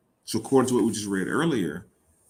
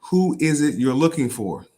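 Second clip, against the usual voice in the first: higher, louder questioning voice; Jesus asking, louder; 6 semitones higher, 6 dB louder than usual. Retold or imitated. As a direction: call it out like he's searching with her.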